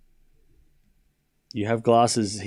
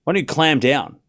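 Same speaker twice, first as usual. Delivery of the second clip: usual speaking voice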